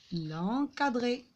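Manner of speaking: normal talking voice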